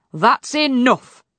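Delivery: loud, slow, firm command; stern king. A narrator's reading. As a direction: put a stop to it firmly. King cuts off daughter's complaining.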